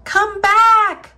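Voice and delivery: high, loud call after someone; shout. A narrator's reading. Aluki calling her runaway huskies back, shouting. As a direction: call out loudly, drawing out the last word. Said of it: "Aluki shouted".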